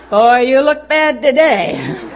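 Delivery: slow, loud laughing jab; laughing mock visitor. Visitor teasing a sick patient, laughing loudly and slowly. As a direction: ham up a tactless visitor through laughter. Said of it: "that's not encouraging at all".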